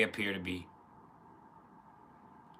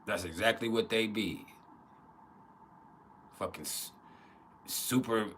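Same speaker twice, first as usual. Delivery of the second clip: ordinary talk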